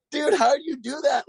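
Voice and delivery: high, incredulous cry of disbelief; amazed. Retold or imitated. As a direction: ask it in awe. Amazed question to Smolik.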